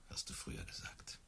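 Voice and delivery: whispered. Acted. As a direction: whisper it, wistful. Wehmütige Erinnerung. (geflüstert, kaum hörbar)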